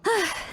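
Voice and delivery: a long tired sigh, falling; weary sigh. Acted. Traveler sighs after collapsing, weary sigh. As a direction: let the breath out slowly, worn out.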